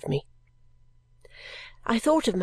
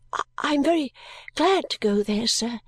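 higher voice with a hesitant start; timid child; 4 semitones higher than usual. Acted. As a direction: answer haltingly as an anxious child. Timid child answers stranger.

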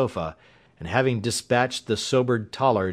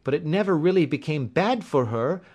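higher-pitched, regretful servant woman; high-voiced housekeeper setting things straight; gaunt servant woman; 8 semitones higher than usual. A narrator's reading. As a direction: explain deferentially as a servant woman. Narrator voices Mrs. Toller.

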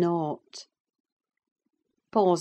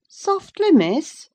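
put-on high, loud check on a child; reproving servant; 13 semitones higher, 9 dB louder, slower than usual. Servant answers the bossy girl, reproving. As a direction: rein her in, voice raised.

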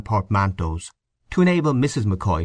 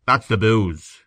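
firm; 3 dB louder, slower than usual. A narrator's reading. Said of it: "said Mister Cunningham firmly".

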